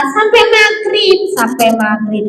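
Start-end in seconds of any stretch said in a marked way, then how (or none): 0.1-1.2 high puppet voice; high-pitched parroted answer; puppet pupil. Acted. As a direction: echo it high as a little puppet.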